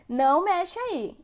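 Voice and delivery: acted parental command. Parent telling a child not to touch, acted command. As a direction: voice a parent's slow warning. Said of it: "Quando a gente fala assim".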